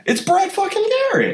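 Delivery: high, hammed-up celebrity voice; Brad Garrett impression. Shown as a demonstration. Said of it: "What is that?".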